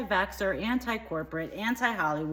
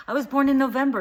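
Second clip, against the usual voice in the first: indignant; 6 semitones higher, 9 dB louder, faster than usual. Acted. Indignant character insists.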